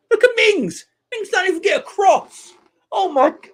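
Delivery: very high, loud disbelief; exasperated outburst. Real, not acted. Fan watching Mings let a cross in, exasperated outburst. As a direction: yell in outrage at the defending.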